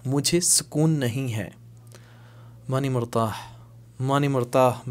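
usual speaking voice

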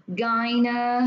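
Gyne drawn out as gy-ne; first two syllables. Shown as a demonstration. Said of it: "là hai âm nè".

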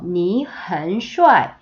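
very slow, syllable by syllable; slow teacher demo; slow model reading. Shown as a demonstration. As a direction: spell out 你很帅 slowly. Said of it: "우리 이 표현 배울 때".

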